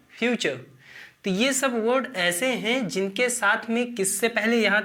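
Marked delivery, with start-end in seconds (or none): 0.1-0.6 फ्यूचर said fyoo-char with ch; teaching pronunciation. Shown as a demonstration. Teacher models future for the class. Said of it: "क्या होता है?".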